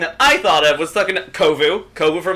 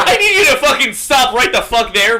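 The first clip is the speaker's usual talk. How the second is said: laughing outcry; 10 semitones higher, 6 dB louder than usual. Real, not acted. Laughing outcry at fan fiction.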